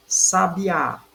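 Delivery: "Sabiá" with a strong last syllable, Á; stress on final Á. Shown as a demonstration. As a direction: lean on the Á of "Sabiá". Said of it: "acento agudo na última sílaba".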